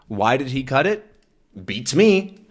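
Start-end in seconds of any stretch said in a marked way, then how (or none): 1.7-2.4 "Beats me" tossed off high and light; casual usage. Shown as a demonstration. Teacher showing how the phrase sounds in use, breezy. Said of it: "this is a good phrase".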